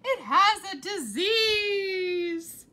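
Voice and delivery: very slow, loud, high crying wail; panicked wail. A narrator's reading. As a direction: howl it in horror. Mother panics.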